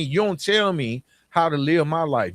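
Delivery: ordinary talk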